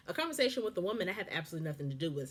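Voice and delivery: ordinary delivery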